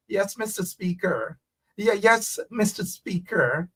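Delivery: soft, prim put-on British voice; mock British accent. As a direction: demure mock-British politeness. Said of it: "they want to sound more British".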